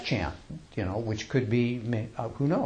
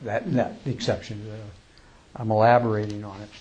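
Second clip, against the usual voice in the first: normal talking voice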